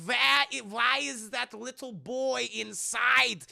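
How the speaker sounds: high, slow, hammed-up German villain; Nazi scientist. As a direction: shriek orders like a cartoon mad scientist. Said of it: "the Nazi scientist is like".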